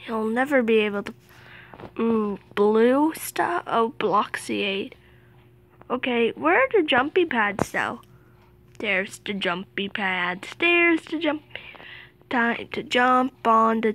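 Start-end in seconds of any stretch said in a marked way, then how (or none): none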